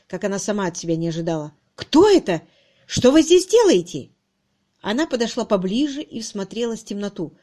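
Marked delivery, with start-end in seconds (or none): 1.7-4.1 high, loud, alarmed demand; sharp challenge. A narrator's reading. Harriet to a figure in the dark, sharply. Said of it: "заставили её голос звучать так резко".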